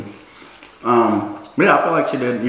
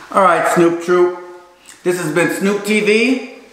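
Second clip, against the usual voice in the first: outro announcer; 6 semitones higher, 3 dB louder, slower than usual. Real, not acted. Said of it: "channel outro sign-off".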